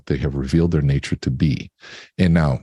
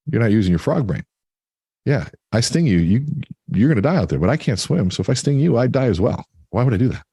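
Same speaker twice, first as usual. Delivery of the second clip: higher-pitched storyteller's scorpion, arguing its case; acted persuasion; 6 semitones higher than usual. Scorpion argues with frog.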